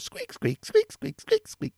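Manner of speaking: high squeaky chair noise; mimicking the chair squeaking side to side; squeaky chair imitation. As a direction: squeak like a creaky office chair.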